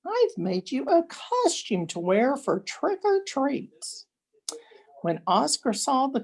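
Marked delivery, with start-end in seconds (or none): 0.0-4.1 much higher, sing-song mother voice; a proud mother greeting her son; doting mother. A narrator's reading. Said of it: "said Oscar's mother".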